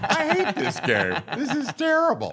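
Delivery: high, laughing mock complaint; laughing frustration. Actor laughing about being shot in Halo, high mock complaint. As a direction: moan through laughter about losing.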